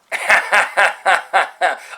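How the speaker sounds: loud, high staccato laugh; big put-on "ha ha ha"; mocking laugh. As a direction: laugh in evenly spaced, scornful bursts. Laughs at the promise as joke.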